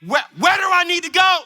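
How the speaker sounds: high, fast, panicked question; acted frantic alarm; urgent. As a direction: fire it off, ready to race out. Panicked pastor ready to go.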